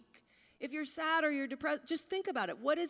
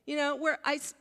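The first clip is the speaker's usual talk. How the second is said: ordinary talk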